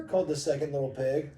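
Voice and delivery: usual speaking voice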